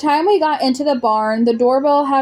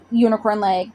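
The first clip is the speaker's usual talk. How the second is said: usual speaking voice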